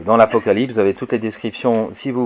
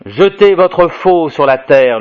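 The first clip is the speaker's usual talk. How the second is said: slow, high proclamation; declaimed cry, drawn out and raised; angel's cry; 6 semitones higher, 7 dB louder, slower than usual. A narrator's reading. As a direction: call out the order like a herald. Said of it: "lui crie à l'instant un autre ange".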